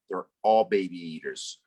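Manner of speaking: ordinary delivery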